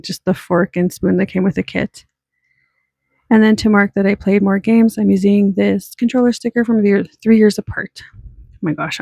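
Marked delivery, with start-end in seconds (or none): none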